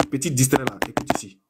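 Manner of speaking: hushed, teasing put-down; soft mocking aside. Real, not acted.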